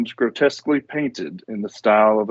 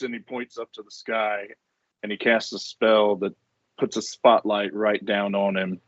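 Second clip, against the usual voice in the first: ordinary talk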